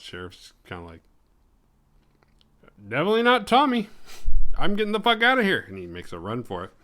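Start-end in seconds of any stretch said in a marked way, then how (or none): 2.9-5.6 high, loud and rushed panic; mock terror, high and fast; panicked getaway. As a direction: blurt it out fast while bolting. Sheriff flees in panic.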